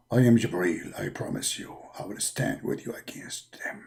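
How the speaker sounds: very low, hushed angel voice; Jibreel's voice. Acted. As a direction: speak deep and hushed. Jibreel reassures.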